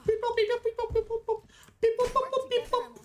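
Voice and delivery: high, chirpy robot beep-boops. Pretend. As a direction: beep like a tiny robot engineer.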